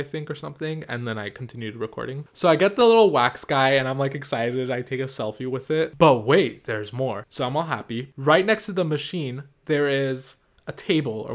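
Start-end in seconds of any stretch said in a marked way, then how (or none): none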